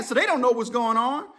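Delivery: ordinary delivery, nothing marked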